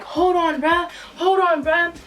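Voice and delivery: high, urgent protest; intervening friend. Retold or imitated. Best friend stepping in on the fight, high urgent objection. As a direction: imitate a friend breaking up a fight.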